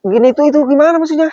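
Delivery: furious challenge to the stalker; angry confrontation. Real, not acted. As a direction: push back hard and quick.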